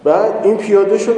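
usual speaking voice

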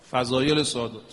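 ordinary talk, nothing marked

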